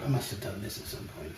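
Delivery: breathy whisper to himself. Real, not acted. Tasting his own cooking, whispering to himself. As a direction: whisper it under your breath, half to yourself. Muttering to self while tasting.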